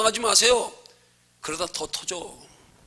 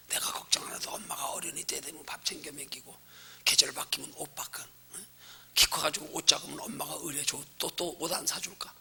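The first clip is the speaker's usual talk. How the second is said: hushed, much softer telling; quiet, intimate aside to the flock; soft whisper; 4 semitones lower, 8 dB quieter than usual. Acted. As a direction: whisper it like a bedtime secret. Soft child-like trust example.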